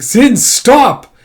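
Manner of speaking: high, loud command to stop; urgent shout. Acted. Character halting Zin's threat in a quarrel, urgent shout. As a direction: yell to break up the fight.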